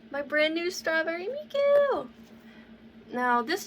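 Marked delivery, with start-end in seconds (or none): 0.1-2.1 bright, proud reveal, louder; excited. Real, not acted. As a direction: show it off with excitement. Showing off her new cosplay.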